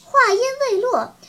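plain reading voice

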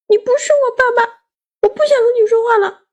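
high-pitched cross outburst; angry child. A narrator's reading. Child refusing to talk to father, angry. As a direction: snub dad in a high, huffy voice. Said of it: "我生气地说".

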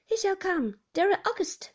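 high, loud shout to a deaf ear. Acted. Beatrice shouts to her deaf grandmother about Roger. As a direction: call it out over the distance. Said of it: "shouted Beatrice".

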